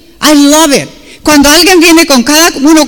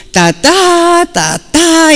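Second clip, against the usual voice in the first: higher-pitched "ta-ta" hammer blows; imitated hammering; 5 semitones higher, much slower than usual. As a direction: mimic swings of a hammer with the voice. Mimics hammering that misses the nail.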